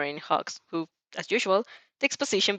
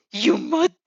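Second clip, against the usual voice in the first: sharp, higher and louder snap, cut short; angry outburst cut off; 9 semitones higher, 5 dB louder than usual. Acted. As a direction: bite the word off in anger. Todoroki cut off in anger.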